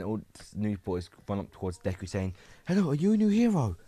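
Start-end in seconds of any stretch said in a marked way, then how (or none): 2.7-3.7 high, loud, rapid question; eager crowd rushing the new hero; excited young fans. Acted. Crowd of boys rushes up to hero.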